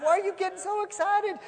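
high-pitched incredulous question; baffled protest. Acted. Neighbor asks the praising lady why, high and baffled. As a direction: demand it in exasperated disbelief.

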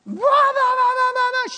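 loud, high-pitched mock rant; mimicked screaming outburst, high and loud; imitated screaming tirade. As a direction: blast out gibberish like an enraged protester. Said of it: "tore into me screaming".

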